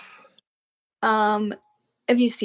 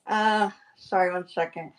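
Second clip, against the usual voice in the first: talking around a paintbrush; paintbrush in mouth. Real, not acted. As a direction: mouth full, muffled words. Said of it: "I've got a paintbrush in my mouth".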